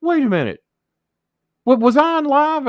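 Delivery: hammed-up startled Jimmy impression; imitating a panicked Jimmy. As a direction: screech the sudden panic as Jimmy. Jimmy voiced in angry call.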